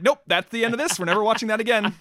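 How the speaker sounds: loud, high, firm refusal; loud exclaim. Retold or imitated. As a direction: declare it loudly, done with it. Retelling their reaction to a show.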